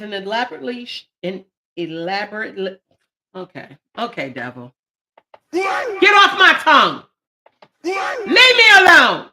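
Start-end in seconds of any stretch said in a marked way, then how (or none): 4.3-4.7 low muttered devil; low aside at the devil; low warning to the devil; mock rebuke. Rebuking the devil on her tongue. 5.5-9.3 higher, louder, slow shouted rebuke; shouted hammed-up rebuke, slow; mock exorcism shout. Rebuking the devil on her tongue.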